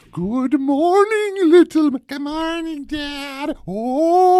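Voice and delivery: high, slow creepy wake-up call; wrestling manager. Acted. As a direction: spook the kid with a ghoulish good morning. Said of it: "so it's fun when you".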